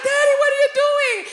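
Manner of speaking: shocked shout. Retold or imitated. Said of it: "I was like".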